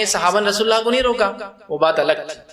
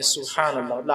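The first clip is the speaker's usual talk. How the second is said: soft reminder; 6 semitones lower, 6 dB quieter than usual. Shown as a demonstration. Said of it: "وہ اس کو لقمہ دیں گے".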